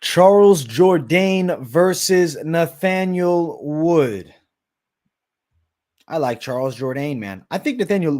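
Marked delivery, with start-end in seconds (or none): 0.0-4.3 slow, louder announcer call, stretched out; ring announcer. Pretend. Announcing the fight.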